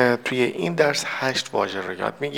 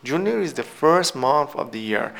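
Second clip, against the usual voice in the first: slow model reading; slower than usual. Shown as a demonstration. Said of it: "teacher reads example sentence".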